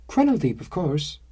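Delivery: high bright matter-of-fact reply; cheerful answer. Acted. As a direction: toss it off brightly.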